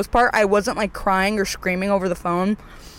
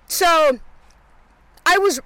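ordinary delivery

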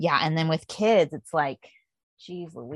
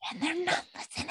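much higher and much softer echo; echoed complaint; 10 semitones higher, 11 dB quieter than usual. Real, not acted. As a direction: lift it high and drop the volume. Echoing complaint about kids.

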